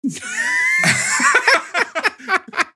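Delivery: high, shrieking burst of laughter. Real, not acted. Podcast host cracking up at the joke, shrieking laugh.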